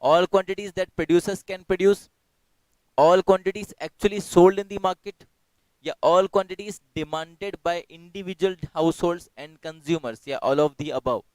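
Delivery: slow, loud, deliberate option reading. Acted. Teacher reading answer options to online class, slow and loud. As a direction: read each choice out clearly.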